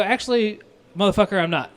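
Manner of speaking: loud, high, indignant comeback; retold pissed-off retort. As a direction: fire back at a rude customer, retelling it. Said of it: "I'm fucking pissed".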